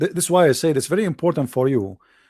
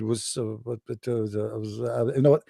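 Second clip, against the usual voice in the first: much softer, faltering; mock fumbling; 6 semitones lower, 8 dB quieter than usual. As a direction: pretend to be lost for words. Speaker feigns confusion.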